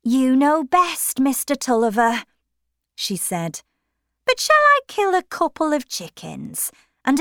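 anxious wife deferring to husband; Mrs Tulliver's voice. A narrator's reading.

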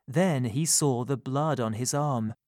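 ordinary delivery

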